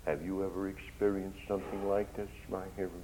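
usual speaking voice

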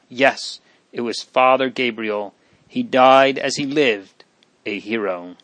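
plain reading voice

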